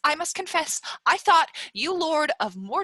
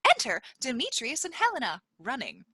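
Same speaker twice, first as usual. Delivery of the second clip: normal talking voice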